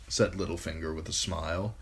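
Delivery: ordinary delivery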